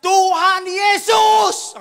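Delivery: high, drawn-out full-voice shout; re-enacted prayer scream, long vowels; shouted cry to Jesus. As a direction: cry out to God at full force. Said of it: "langsung teriak".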